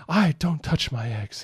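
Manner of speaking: a child's hammed-up queenly warning; Queen Coral. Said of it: "So read it like Queen Coral would".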